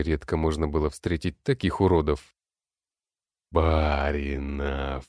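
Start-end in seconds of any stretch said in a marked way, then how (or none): 3.5-5.0 low, slow inhuman growl; monstrous growl. Acted. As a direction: drag it out like a monster. Necromant's monstrous call.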